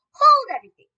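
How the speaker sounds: piercing, high and very loud; witch's shriek. A narrator's reading. Witch halting the whole ballroom, shrieking. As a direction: shriek it like a trumpet blast. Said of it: "Her shrieking voice echoes through the ballroom".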